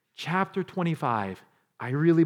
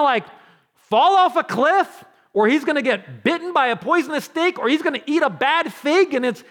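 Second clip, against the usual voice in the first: loud, high, tumbling list; impassioned preaching; 12 semitones higher, 9 dB louder than usual. Acted. Preacher voicing David's trust that Saul will fall somehow, impassioned. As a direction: pile up the possibilities at full force.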